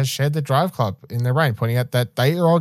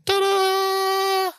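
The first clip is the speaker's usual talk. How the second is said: much higher mock fanfare cry. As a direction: sing it out like a magician. Fanfare for the segment.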